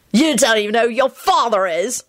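put-on high, taunting boy's voice; mocking. A narrator's reading. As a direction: jeer at the boy. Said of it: "called out in a mocking voice".

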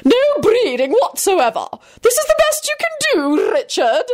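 very high haughty disdain; high-pitched contemptuous tirade; shrill contempt. A narrator's reading. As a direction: scold shrilly, full of scorn. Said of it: "Caroline shrilled".

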